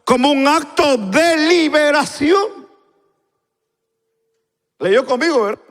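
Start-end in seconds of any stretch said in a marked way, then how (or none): 0.0-2.7 high, slow, emphatic reading; emphatic preaching. Acted. Preacher reads a definition to the congregation, emphatic. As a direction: proclaim it in long raised phrases.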